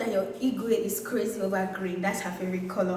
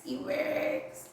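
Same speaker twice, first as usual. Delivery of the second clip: laughing; 6 semitones higher, 3 dB quieter than usual. Real, not acted. Admiring scarf, then laughs.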